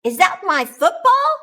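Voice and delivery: high, loud surprised question; sudden surprised high question. Acted. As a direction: Bob spotting his own football, surprised. Bob finds his football.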